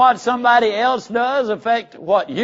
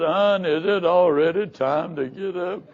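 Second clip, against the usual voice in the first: groggy sleeper; 3 semitones higher, 3 dB louder than usual. Retold or imitated. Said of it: "slap their face and said".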